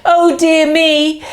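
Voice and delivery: ordinary delivery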